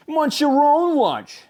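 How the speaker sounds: high, slow, peeved friend voice; annoyed fish friend. A narrator's reading. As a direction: scold a greedy pal. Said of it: "said his best friend".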